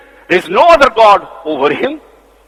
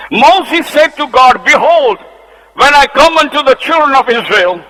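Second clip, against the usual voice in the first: scripture reading; 3 semitones higher, 4 dB louder than usual. Acted. Preacher reading Moses and God aloud to the church, raised and declaiming. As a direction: proclaim the verses to the congregation.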